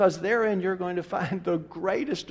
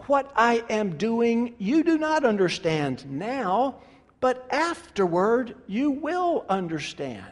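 slow, tender voice of Jesus; gentle Jesus; 7 semitones higher, slower than usual. Acted. Preacher voicing Jesus gently to Peter. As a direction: speak it slowly, as a patient teacher.

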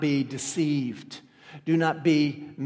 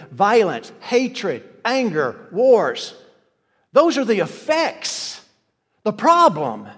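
each word louder, climbing; building preaching; 3 semitones higher, 6 dB louder, slower than usual. Acted. Pastor listing the effects of false Christs, building loud. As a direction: stack the sins, rising. Listing sins with rising force.

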